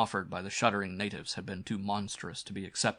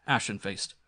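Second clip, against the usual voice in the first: plain reading voice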